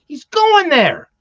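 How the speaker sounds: loud, high, drawn-out cry; excited shout. Real, not acted. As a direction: shout it out slowly, stretching the words. Excited outburst about Carnegie Hall.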